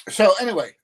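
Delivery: loud, high reset; loud so anyway. Real, not acted. Returning to his viewers after the chat, loud. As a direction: pull the talk back. Snapping back to topic.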